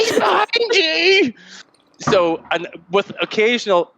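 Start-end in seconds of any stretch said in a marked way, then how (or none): 0.0-1.3 high laughing shout of alarm; panicked shout. Retold or imitated. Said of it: "so I was like".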